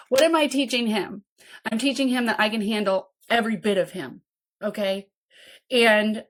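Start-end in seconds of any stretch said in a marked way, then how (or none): none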